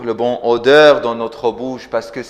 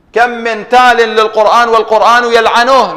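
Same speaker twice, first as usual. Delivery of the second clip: reciting a hadith; 10 semitones higher, 7 dB louder, much faster than usual. Acted. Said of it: "Ça, c'est le hadith du prophète".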